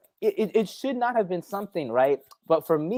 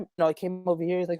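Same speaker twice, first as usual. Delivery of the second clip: usual speaking voice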